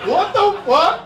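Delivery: very high, loud disbelieving shout; comic outrage. Real, not acted. Comedian reacts to audience question, high outraged shout. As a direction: yell it in indignant disbelief.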